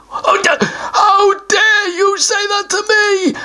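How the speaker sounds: high, slow shout with a fake sob; a troll throwing a tantrum; outraged troll. Troll furious at the answer pea, shouting.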